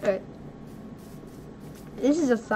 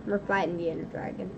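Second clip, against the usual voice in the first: softer and quick, almost tossed off; quiet quick take; 5 semitones lower, 9 dB quieter, faster than usual. Shown as a demonstration. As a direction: say it low and fast like a secret. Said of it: "I sound like a what?".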